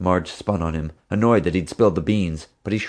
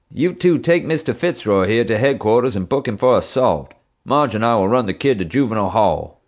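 bossy officer voice; 4 semitones higher, 4 dB louder than usual. Acted. Bubba giving orders.

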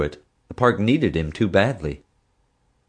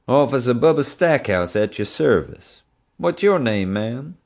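a lightly voiced puffed-up officer, pitched higher; pompous deputy; 6 semitones higher than usual. A narrator's reading. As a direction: show off like a small-town cop. Bubba introduces himself.

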